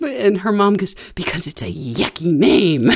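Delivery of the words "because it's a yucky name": lower, prissy clipped answer; prim mom. Retold or imitated. Uptight mom answering her teasing daughter, prim. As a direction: play the uptight mother.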